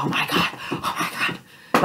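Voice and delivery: whispered breathless oh my God; breathless fangirl whisper. Retold or imitated. Fan imagining the confession, breathless whisper. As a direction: gasp it out in a whisper. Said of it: "and I'm just like".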